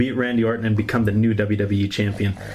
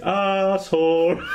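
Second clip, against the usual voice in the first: drawn-out Canadian chant of asshole; Canadian accent; 8 semitones higher, much slower than usual. Retold or imitated. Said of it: "chanting asshole in Canadian accent".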